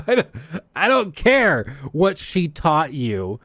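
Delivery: hammed-up exasperation, slow and high; exasperated. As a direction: dismiss her with drawn-out, theatrical irritation. Exasperated reply to gushing actress.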